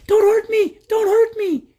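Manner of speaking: put-on very high, pleading old man; frightened cry. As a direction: squeal it out in panic. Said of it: "cried the old man".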